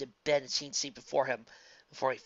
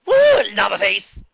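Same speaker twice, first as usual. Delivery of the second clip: high loud slow whoop; loud exuberant holler; excited whoop; 7 semitones higher, 17 dB louder, slower than usual. Acted. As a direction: cheer it out wildly. Character exclaims.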